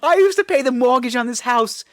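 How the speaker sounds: mock wounded ex-husband; aggrieved ex-husband. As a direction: claim the house back angrily. Acted ex-husband's grievance.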